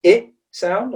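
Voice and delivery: short lax "I" as in chip, lifted high. Shown as a demonstration. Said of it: "with this".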